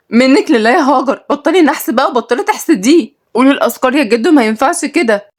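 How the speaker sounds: put-on girl blaming her cousin for jinxing grandpa; scolding girl.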